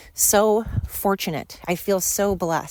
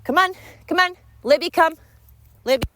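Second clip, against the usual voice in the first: high, raised call to a dog; 10 semitones higher, 5 dB louder, faster than usual. Real, not acted. Breaking off to call the dog, calling out. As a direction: call out sharply higher and louder.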